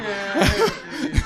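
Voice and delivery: bursting into high laughter; loud high laugh. Real, not acted. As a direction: crack up loudly. Big laugh at own joke.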